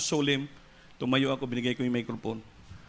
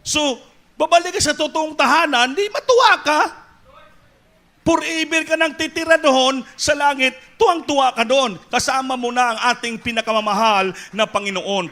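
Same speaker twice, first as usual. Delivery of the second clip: high, loud exultant preaching with a laugh; laughing, exultant sermon peak; joyful preaching; 12 semitones higher, 9 dB louder than usual. Real, not acted. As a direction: rejoice over heaven at the top of the voice.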